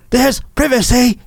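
high hammed-up British accent, Mike Myers style; mocking a man's fake British accent; Mike Myers character. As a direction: play a silly British Mike Myers caricature. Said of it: "He sounds like a Mike Myers character".

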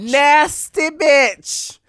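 slow, disgusted sneer; disgusted drawn out. Real, not acted. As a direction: stretch it out in disgust. Disgusted reaction to sandwich.

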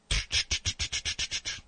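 repeated unvoiced slap noises, fading; slap sound effect. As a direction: mimic a Benny Hill head slap. Imitating slapping a bald head.

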